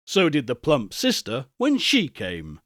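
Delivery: usual speaking voice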